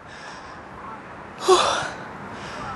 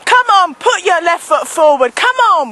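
usual speaking voice

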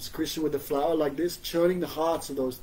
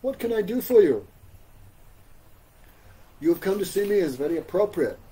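higher, louder, feigning eager helpfulness; Krishna, mock helpful; 4 semitones higher, 4 dB louder than usual. Krishna pretending to serve the visiting ladies, mock eager. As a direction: play the tricky god offering help. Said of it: "Krishna speaking like this".

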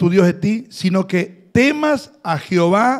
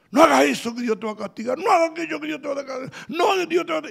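mock scolding; 6 semitones higher, much faster than usual. Imitating scolding church elders.

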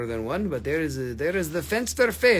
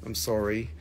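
usual speaking voice

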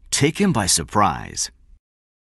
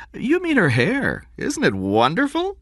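gushing woman's voice. A narrator's reading. Narrator voicing Liz.

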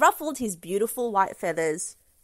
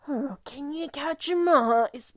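purring cat; 5 semitones higher than usual. A narrator's reading. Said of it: "purred a cat".

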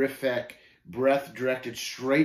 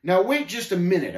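raised, louder objection; interjecting; 7 semitones higher, 8 dB louder than usual. A narrator's reading. Pilgrim trying to stop Shame's speech, protesting. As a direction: try to break into a tirade. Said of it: "I tried to interject".

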